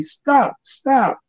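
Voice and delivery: sharp high-pitched plea; a kid crying out to stop the beating; urgent cry. Retold or imitated. Boy calling out to stop the men, high urgent cry.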